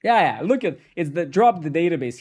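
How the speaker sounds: excited outburst. Real, not acted. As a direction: jumps in loud and high, pointing at chat. Reading chat excitedly.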